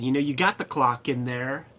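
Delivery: usual speaking voice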